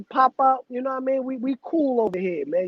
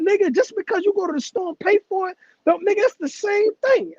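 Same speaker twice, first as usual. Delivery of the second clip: a hint of a scolding grandma, higher; mock his grandma setting him straight, higher; lightly voiced grandmother; 8 semitones higher than usual. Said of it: "She said".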